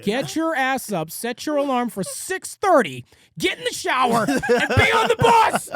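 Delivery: high loud yell, rising to a laugh; yelling strict dad. Retold or imitated. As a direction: shout the orders like a fed-up dad. Dad yelling at lazy son.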